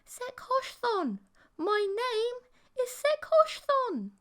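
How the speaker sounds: very high, slow alien whisper into an ear; urgent whisper. Acted. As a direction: hiss the name insistently. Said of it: "whispered into my ear".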